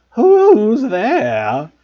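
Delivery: loud high cackling poltergeist; Peeves' voice. A narrator's reading. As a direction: call out like a mischievous ghost.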